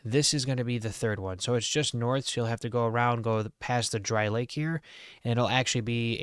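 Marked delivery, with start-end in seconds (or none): none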